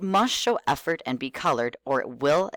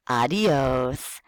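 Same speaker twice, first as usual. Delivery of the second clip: kindergarten teacher voice. Shown as a demonstration. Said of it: "talk to them like you're a kindergarten teacher".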